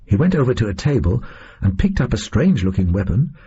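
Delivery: ordinary delivery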